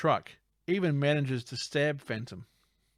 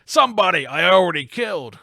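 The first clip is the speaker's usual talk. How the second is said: loud, higher acted boss; villain boss; 4 semitones higher, 10 dB louder than usual. Boss to henchman in the fleeing truck, hammed-up shout. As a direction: throw it back loudly, hammed-up.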